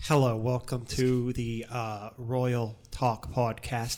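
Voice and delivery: slow mock posh British accent; lightly put-on British accent. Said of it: "doing British accents".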